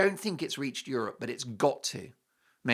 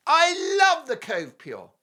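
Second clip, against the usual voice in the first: excited; 11 semitones higher, 3 dB louder, slower than usual. Acted. Gushing over sponsor.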